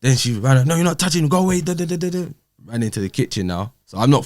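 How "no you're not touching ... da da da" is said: higher, mimicking her yelling; mock shout. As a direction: play her pushing him away. Retold ex shouting.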